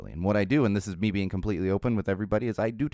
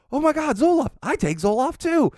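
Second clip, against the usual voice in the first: excited; 5 dB louder than usual. Retold or imitated. Guy thrilled to share Zoloft.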